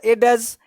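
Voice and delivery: "एडज" with a separate d before j; d sounded. Shown as a demonstration. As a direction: say "एडज" as spelt, d then j. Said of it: "ऐसे नहीं पढ़ना है".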